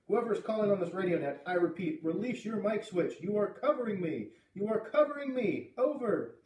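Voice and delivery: insistent radio operator, pitched high. A narrator's reading. Voice through static telling caller to release the switch, insistent.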